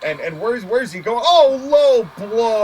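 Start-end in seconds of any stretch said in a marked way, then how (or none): 1.2-2.6 appalled cry. Real, not acted. Cheap shot in match.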